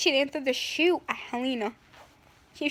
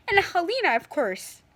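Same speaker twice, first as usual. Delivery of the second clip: normal talking voice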